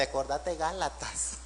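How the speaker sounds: soft, sweet reminder to an angry wife; gentle reminder to spouse. Retold or imitated. Husband softly reminding his shouting wife, tender and quiet. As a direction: coax her gently, like a loving husband. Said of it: "cuando vea a su esposita linda".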